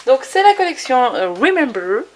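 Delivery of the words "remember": put-on a playful stab at an English accent on "Remember"; "Remember" in a jokey put-on English accent; mock English accent. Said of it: "Mon super accent anglais".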